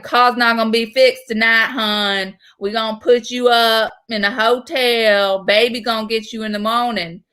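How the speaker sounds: put-on thick, rural Southern accent; old Southern man. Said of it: "that old Southern man".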